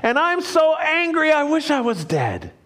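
a touch of a hammed-up tantrum; sulky angry Jonah. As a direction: whine it like a furious, sulking kid. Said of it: "This is Jonah's response".

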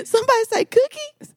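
very high squeaky bird voice; hawk voice. Pretend. Laughing along with the running hawk joke, very high squeak.

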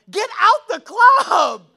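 loud, high and very slow; preaching shout. Real, not acted. Preacher yelling at a young woman in the club, a slow loud shout. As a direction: bellow the command at the crowd. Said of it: "preacher shouting at congregation".

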